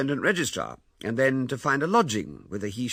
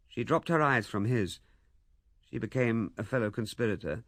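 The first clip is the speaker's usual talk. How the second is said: ordinary delivery, nothing marked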